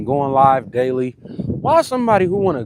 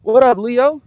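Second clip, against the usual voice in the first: higher, louder burst of surprised greeting; delighted recognition; 10 semitones higher, 5 dB louder than usual. Real, not acted. Streamer recognizing Leo in the live chat, delighted recognition. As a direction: greet someone you have just placed.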